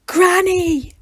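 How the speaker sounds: high loud startled cry of Granny; dismayed cry. Acted. Granddaughters seeing Granny arrive, a startled high cry. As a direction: shriek it in shock.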